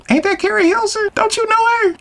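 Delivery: very high falsetto imitation; mocking girlfriend voice. Girlfriend spotting the celebrity who ignored him, mock falsetto. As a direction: put on a squeaky falsetto, questioning him. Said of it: "So old girl's like".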